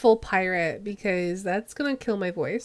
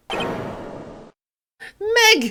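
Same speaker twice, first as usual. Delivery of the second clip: put-on high Irish-accented call; 9 semitones higher than usual. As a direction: call out as an Irish old man. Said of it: "I'll make him Irish".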